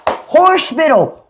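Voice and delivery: HOSPITAL drawn out as "horse-pittle", high and slow; slow, high-pitched "horse-pittle" for Hospital; Southern pronunciation. Shown as a demonstration. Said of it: "you right on that hospital shit".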